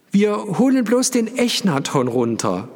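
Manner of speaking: high, carrying call; calling after someone. A narrator's reading. Narrator calling after Frau Fethke, calling out. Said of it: "rief ich ihr nach".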